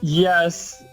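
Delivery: ordinary delivery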